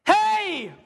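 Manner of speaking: loud high call across the valley; loud high hey; shouting out. Acted. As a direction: call out to get the camp's attention. David calling out to Saul.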